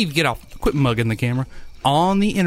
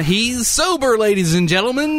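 announcer voice; 7 semitones higher than usual. Pretend. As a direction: present him like a circus act. Mock announcing the guest's exit.

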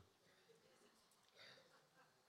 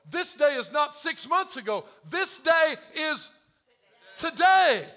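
full-force preaching; 10 semitones higher, 13 dB louder, slower than usual. Acted.

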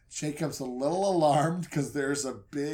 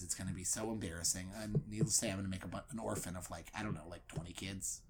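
much softer, fast, lower, close to a whisper; terrified Jacob muttering to himself before his beating; panicked mumble; 9 semitones lower, 12 dB quieter, faster than usual. Acted. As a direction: fret under your breath as if doom is near. Jacob panicking over the coming fight.

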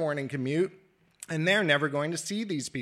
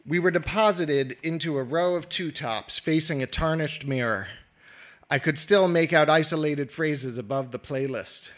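ordinary talk, nothing marked

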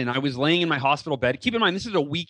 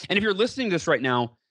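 ordinary talk, nothing marked

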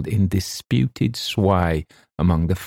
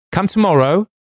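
high, loud call with rising end; 13 semitones higher, 6 dB louder than usual. A narrator's reading. Wilde sends the visitor away through the door, high rising call.

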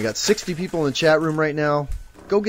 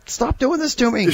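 mock exasperation; 13 semitones higher, 4 dB louder than usual. Real, not acted. Cohost keeps adding prizes.

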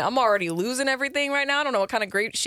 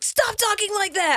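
mock annoyed husband; 11 semitones higher than usual. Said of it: "And he's like".

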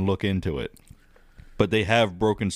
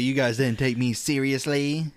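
menacing creature voice, pitched up; evil Bat Boy voice; 5 semitones higher than usual. Acted. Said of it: "That's my, like, evil Bat Boy voice".

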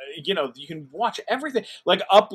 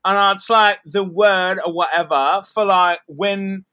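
higher, louder, lightly voiced bubbly British guest; imitating Chloe; 3 semitones higher, 6 dB louder than usual. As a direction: mimic her eager ramble. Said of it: "And she said".

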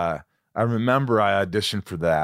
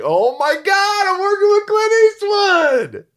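very high, loud, drawn-out mock freak-out; hammed-up awestruck outburst; starstruck yell; 9 dB louder, slower than usual. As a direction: squeal it slowly at full volume, overwhelmed. Acts out starstruck inner reaction.

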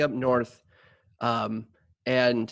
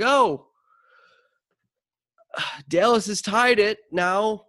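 high, slow, sighing announcer imitation; mock a bored radio play-by-play guy; 7 semitones higher, slower than usual. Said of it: "but he's awful".

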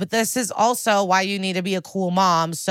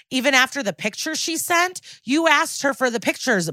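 incredulous high-pitched grilling; mock accusation; 7 semitones higher than usual. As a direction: imitate an indignant interrogator. Acted confrontation by Barba.

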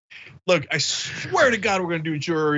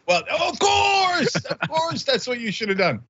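high, slow drawn-out agreement; 高声拖长调侃; mock emphatic; 10 semitones higher, slower than usual. Real, not acted. Ironic agreement.

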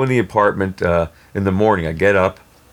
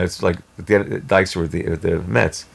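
normal talking voice

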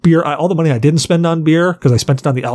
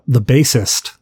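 ordinary talk, nothing marked

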